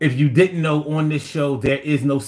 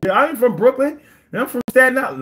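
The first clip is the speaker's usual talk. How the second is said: mock caller; 11 semitones higher, 4 dB louder, faster than usual.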